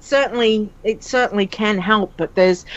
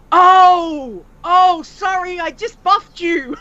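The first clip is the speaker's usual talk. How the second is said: higher, louder flustered apology, slowing at the end; apologetic stranger; 9 semitones higher, 4 dB louder, much slower than usual. Retold or imitated. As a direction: play the embarrassed player saying sorry. Other player apologises.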